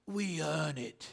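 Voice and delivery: put-on low, soft, slow English actor's accent; John Houseman's English accent. Pastor adapting an ad slogan, low English accent. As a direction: echo the old English spokesman, low and drawn out. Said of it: "he would say in that English accent".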